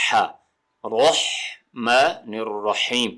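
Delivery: رحمن الرحیم very slow, with pharyngeal ح; correct haa. Shown as a demonstration. Teacher showing the right sound of haa, slow and exact. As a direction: break رحمن الرحیم into syllables, each haa clear from the throat. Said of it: "اس کا جو صحیح آواز ہے وہ ہے".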